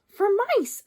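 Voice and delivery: high, loud cry of "For mice"; tailor's exclamation. A narrator's reading. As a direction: burst out with it. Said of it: "said the tailor of Gloucester".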